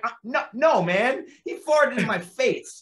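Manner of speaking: indignant protest. Retold or imitated. Actor to director after fart in his face, indignant high protest. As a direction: refuse in comic outrage, slowing for emphasis.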